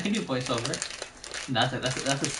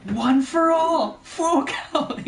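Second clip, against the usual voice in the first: high, loud anime-dub battle cry; Deku; 5 dB louder, much slower than usual. Acted. Showing how an anime dub would sound, high shouted hero voice. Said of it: "Deku's voice".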